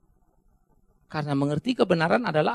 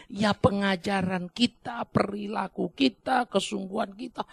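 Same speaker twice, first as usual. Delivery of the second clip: ordinary delivery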